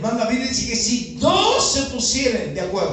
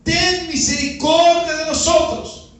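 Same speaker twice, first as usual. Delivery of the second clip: high, drawn-out preacher's shout; crying out; 7 semitones higher, 4 dB louder, slower than usual. Acted. Lepers calling to Jesus for mercy, high pleading shout. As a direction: plead loudly as if to someone passing. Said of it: "dicen que comenzaron a gritar".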